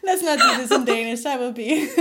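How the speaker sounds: laughing through the words. Real, not acted. Guest jokes about doing it in Danish, laughing. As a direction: giggle it out.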